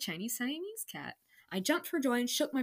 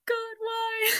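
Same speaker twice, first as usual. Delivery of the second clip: high, loud laughing disbelief; laugh-cry the questions; 12 semitones higher, 11 dB louder than usual. Real, not acted. Cringing at her own story, laughing loudly in disbelief.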